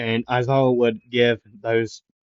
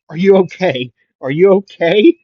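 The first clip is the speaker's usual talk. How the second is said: higher, louder worried calling; urgent concern; 7 semitones higher, 8 dB louder than usual. Acted. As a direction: check on a hurt friend, more insistently. Checking on fallen friend.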